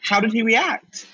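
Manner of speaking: high, concerned voice; Noah's worried question. Retold or imitated. Noah asking LaChance what's wrong, high and concerned. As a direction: ask with worry. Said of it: "so Noah says".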